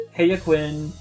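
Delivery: ordinary talk, nothing marked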